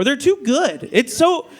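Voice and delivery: ordinary delivery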